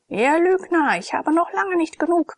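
furious tavern keeper yelling he is not done; roaring innkeeper. A narrator's reading. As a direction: roar it back at the mocking gladiators. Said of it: "brüllte der Wirt".

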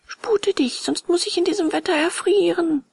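high, pleading puppet voice; high-pitched impatient plea; urgent puppet. A narrator's reading. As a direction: urge on in a high voice. Freezing puppet urges the snail.

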